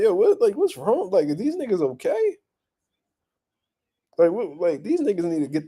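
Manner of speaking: high astonished question; incredulous. Retold or imitated. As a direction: ask it in disbelief. Retold own reaction.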